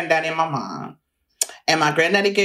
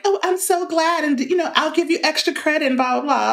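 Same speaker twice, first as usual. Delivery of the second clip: higher, sugary imitation of a teacher backpedalling; mock the teacher's forced sweetness, pitched up; mock fake-nice teacher; 10 semitones higher than usual. Teacher backpedalling to the student, mocked as sugary and high. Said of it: "she tried to be all like".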